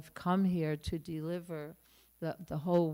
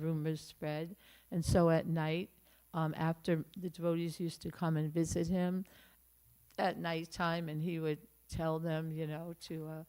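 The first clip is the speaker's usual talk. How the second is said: ordinary talk, nothing marked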